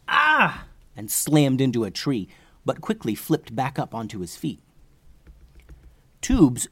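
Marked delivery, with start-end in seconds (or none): 0.1-0.6 high-pitched "ah" cry; cried out in pain. Acted. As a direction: yelp as if blasted in the back. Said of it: "He cried out".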